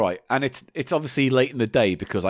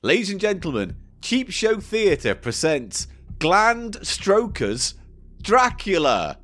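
slow, raised theatrical announcement; show announcer; 7 semitones higher, slower than usual. Pretend. Host introducing Dracula sketch, grand announcer. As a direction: present the play grandly, drawing out the title.

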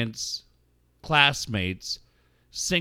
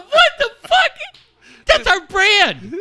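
very high, loud squeal through laughter; shrieked disbelief; 11 dB louder than usual. Retold or imitated. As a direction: yell it out, scandalised and delighted. Acting out shocked hilarity.

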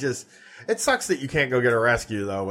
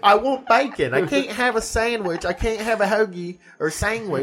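higher whiny Aussie voice; mock Australian whine; 7 semitones higher than usual. Imitating angry Aussie wanting a sandwich, Australian accent. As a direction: pout out the craving in an Aussie accent. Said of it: "Did I do a good Australian accent first?".

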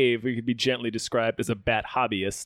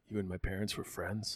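low, very soft acted voice; retold quiet question; 6 semitones lower, 14 dB quieter than usual. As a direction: murmur it in mock puzzlement. Acts out line to the butler.

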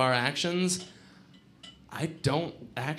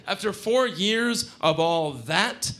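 ordinary delivery

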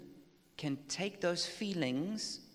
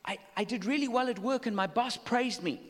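happy, excited quote; 6 semitones higher than usual. Retold or imitated. Someone praised at work, telling it excitedly. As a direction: act out the thrilled coworker. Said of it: "and says".

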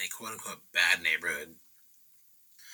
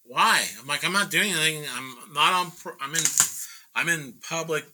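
raised, higher-pitched defensive voice; indignant protest; 7 semitones higher than usual. Retold or imitated. Painter argues with truck owner about calling police, indignant protest. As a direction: protest your innocence with pitch pushed up.

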